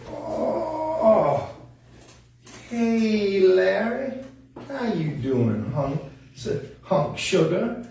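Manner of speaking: high, drawn-out flirty puppet voice; Bettina. Pretend. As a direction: greet him sweetly, stretching the words. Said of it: "Oh, Bettina".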